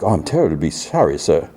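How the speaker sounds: put-on apologetic driver with a British accent. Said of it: "a driver in a British accent".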